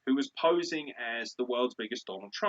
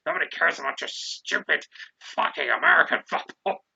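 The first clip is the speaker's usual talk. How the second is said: ranting wrestling boss, raised voice; Vince; 4 semitones higher, 5 dB louder than usual. Acted. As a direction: rage like a promoter defending his show. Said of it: "I've got the voice for it".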